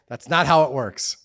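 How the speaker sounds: ordinary talk, nothing marked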